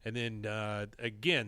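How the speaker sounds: ordinary talk, nothing marked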